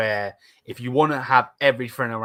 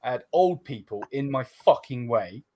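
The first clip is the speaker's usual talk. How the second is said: ordinary delivery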